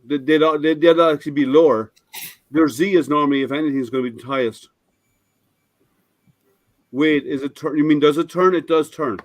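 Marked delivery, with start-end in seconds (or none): none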